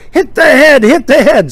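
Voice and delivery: high, loud exasperated order; mock yell. Abbott with hit finger ordering Lou, mock yell. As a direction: imitate a pained, impatient yell.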